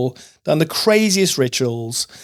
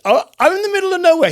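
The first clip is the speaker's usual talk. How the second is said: excited disbelief; 3 dB louder, much faster than usual. Real, not acted. Disbelief at chance meeting.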